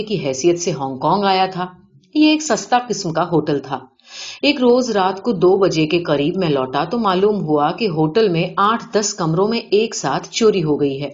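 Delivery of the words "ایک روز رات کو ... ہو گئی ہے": usual speaking voice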